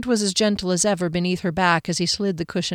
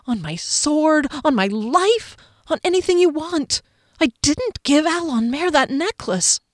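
imploring oath; 6 semitones higher than usual. A narrator's reading. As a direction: swear on everything, begging to be believed.

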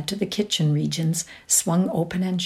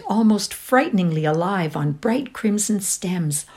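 ordinary delivery, nothing marked

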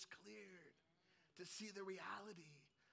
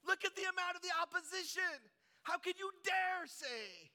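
doubters' outcry; 12 semitones higher, 11 dB louder than usual. Acted. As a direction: shout it as an indignant doubter, stretching the words. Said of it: "because they say".

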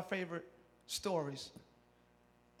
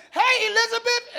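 a lightly voiced, higher cheery "Hey, Elizabeth"; Mary greeting; 9 semitones higher, 5 dB louder than usual. Mary greeting her cousin Elizabeth, lightly voiced cheer. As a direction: greet your cousin brightly. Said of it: "She says".

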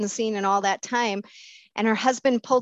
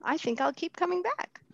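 meek; 8 semitones higher, 6 dB quieter than usual. Retold or imitated.

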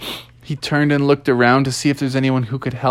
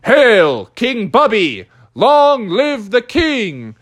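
crowd cheer; 6 semitones higher, slower than usual. Acted. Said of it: "everyone shouted out".

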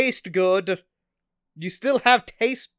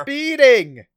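loud, higher called-back answer; shouted reply; 4 semitones higher, 8 dB louder than usual. Acted. Speeding traveller answering a bystander, shouted. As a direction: holler back over the wind.

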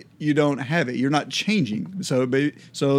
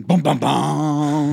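dramatic sting; 4 dB louder, much slower than usual. Pretend. Dramatic sting after topic.

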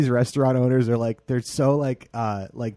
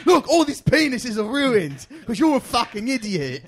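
furious chef; 10 semitones higher, 6 dB louder than usual. Retold or imitated. Gordon Ramsay voice continued.

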